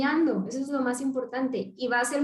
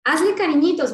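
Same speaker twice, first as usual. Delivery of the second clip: tender instruction; 4 semitones higher, 7 dB louder than usual. Shown as a demonstration. Showing child to be gentle.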